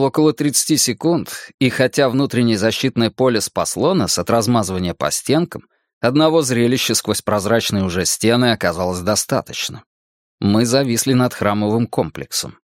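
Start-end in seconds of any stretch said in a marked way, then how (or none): none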